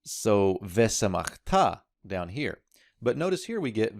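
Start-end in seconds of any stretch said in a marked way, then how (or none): 0.6-1.8 "vesemachta" with the accent on the final "ta"; "vesemachta", stressed at the very end; stress on final syllable. Shown as a demonstration. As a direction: land firmly on the last syllable, "vesemach-TA". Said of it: "going to accent the final syllable".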